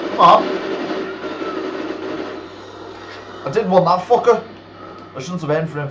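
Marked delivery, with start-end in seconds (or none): 0.1-4.4 loud, exasperated "come on"; frustrated outburst. Real, not acted. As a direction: vent at the game, loud. Frustrated at missed shot.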